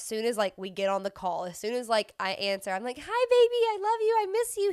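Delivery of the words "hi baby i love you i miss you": put-on high, loud, sing-song baby talk; mom talking to baby. Greeting her toddler on FaceTime.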